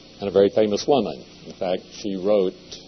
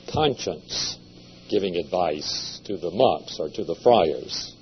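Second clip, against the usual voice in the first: ordinary talk, nothing marked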